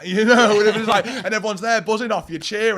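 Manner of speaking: loud, high, laughing excitement; excited. Real, not acted. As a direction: relive the buzz of the crowd. Excited about the crowd.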